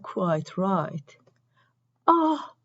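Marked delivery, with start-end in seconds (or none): 2.1-2.5 sudden high shriek of fright; a sharp startled shriek. A narrator's reading. Mira shrieks at the egg in her shoe, sharp high shriek. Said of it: "shrieked Mira".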